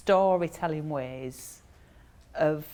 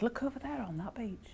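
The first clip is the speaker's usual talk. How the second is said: hushed conspiratorial whisper; excited whisper pointing something out; 4 semitones higher, 9 dB quieter than usual. Retold or imitated. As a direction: lean in and whisper like sharing a secret. Pointing out a secret sighting.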